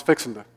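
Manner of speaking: "fixin' to" run together Southern style; mashed into one word. Shown as a demonstration. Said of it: "mash up several words into".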